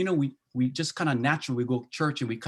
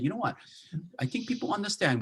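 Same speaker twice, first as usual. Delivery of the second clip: lightly voiced sudden realization, pitched up; retold realization; 7 semitones higher than usual. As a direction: replay the team's little aha, half-acted. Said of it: "So we said".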